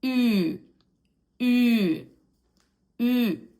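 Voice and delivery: ü drawn out and loud, lips puckered as for a kiss; demonstrated ü sound. Shown as a demonstration. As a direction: hold each ü, a rounded ee, falling in tone. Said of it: "make this extra sound and combine it".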